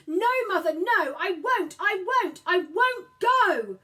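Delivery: high, loud, slow screaming refusal; a boy screams no at his mother, slowing on each word; screaming child. A narrator's reading. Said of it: "he screamed".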